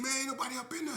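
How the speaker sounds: scared man insisting the room's empty; frightened denial. Retold or imitated. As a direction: squeak out the lie in fear. Man lying to gunman in fear.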